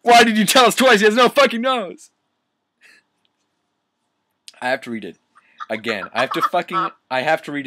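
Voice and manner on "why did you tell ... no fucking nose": loud, high shout through laughter; laughing outburst. Real, not acted. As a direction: exclaim helplessly while cracking up. Cracking up over repeated line.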